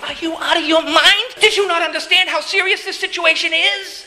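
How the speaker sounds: high, outraged mock yelp; inwardly flipping out. Jairus's inner response to Jesus stopping, outraged disbelief. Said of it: "But inside, he's going".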